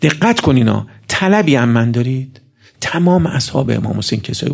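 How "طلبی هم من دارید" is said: usual speaking voice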